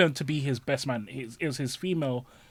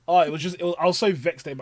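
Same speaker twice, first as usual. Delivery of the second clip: usual speaking voice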